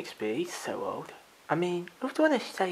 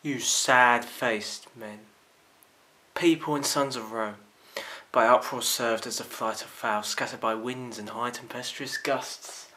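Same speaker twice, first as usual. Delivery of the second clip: roman orator; 6 semitones lower than usual. Acted. Monologue from the top.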